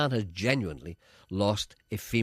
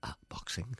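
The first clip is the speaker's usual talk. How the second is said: whisper; 10 dB quieter than usual. Real, not acted. Deadpan answer to obvious question.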